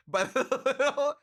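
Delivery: high, giggling, words breaking up; can't finish for laughing; giggled-out phrase; laughing through words. Real, not acted. Laughing while describing art.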